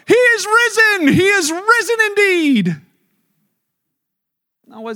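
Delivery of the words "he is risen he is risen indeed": high, loud and slow, shouting in triumph; joyful shout. Pretend. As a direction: proclaim it at the top of your voice. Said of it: "Peter didn't jump out of the tomb and go".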